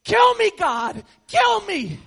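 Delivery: slow, loud, high mock scream of agony; hammed-up wail begging God to end it; pained yell. Retelling firecracker burn to congregation, loud pained yell. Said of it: "Running in circles going".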